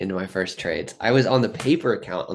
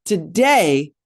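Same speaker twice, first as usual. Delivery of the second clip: high, fast and wound up, words tumbling; a Shia LaBeouf-style frenzy, way up in pitch; hyped-up rant; 12 semitones higher than usual. Real, not acted. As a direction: rev yourself up like a manic trader pep-talking himself. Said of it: "I sound like Shia LaBeouf".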